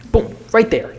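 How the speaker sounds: sudden high excited shout of approval; pumped-up creator reacting to a viral hook; excited burst. Real, not acted. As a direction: hit it like you just spotted the winning move. Excited callout after clip hook.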